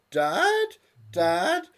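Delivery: put-on high girlish plea; squeaky daughter. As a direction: whine it up like a lovesick girl. Daughter pleads, gun drawn.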